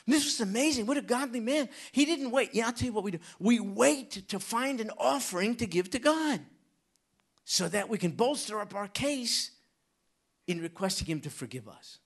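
loud, high, fiery preaching; hammering the point home to the congregation; full-force preaching. Real, not acted. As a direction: preach it out with fire.

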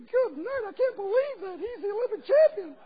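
put-on a grown champion squeaking like a small kid; Paul Hamm's little voice. Preacher mimics gymnast's interview, very high and squeaky. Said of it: "he has a little voice like this".

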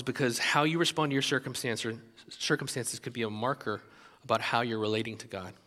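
usual speaking voice

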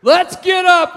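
high, very loud yell; fed-up dad's shout. Retold or imitated. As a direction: imitate a worn-down father giving in loudly. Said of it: "my dad was tired of it".

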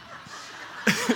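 breathy laugh at oneself; real laugh escaping after a botched impression; sheepish laugh. Real, not acted. Preacher amused by his own accent attempt, breathy laugh.

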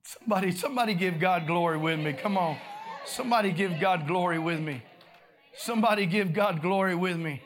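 loud preacher's call for praise; forceful, repeated altar call; rallying call. Real, not acted. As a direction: rouse the congregation, loud and insistent.

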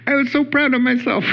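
high-pitched, giggly retold delight; laughing, proud. Student telling her pastor she spoke up, laughing proudly. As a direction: imitate her pleased giggle through the words.

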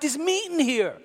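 high, startled realisation; shocked discovery. Retold or imitated. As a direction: gasp it out in disbelief. Discovering meat in ravioli.

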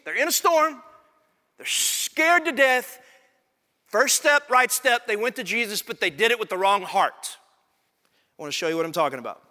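ordinary talk, nothing marked

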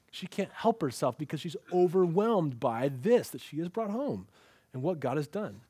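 ordinary talk, nothing marked

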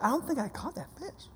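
put-on doubtful kid. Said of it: "she's gonna be like".

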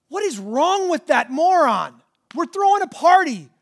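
hammed-up father calling his son a moron; mock exasperation. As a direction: toss it off like a fed-up dad. Father voiced mocking the son.

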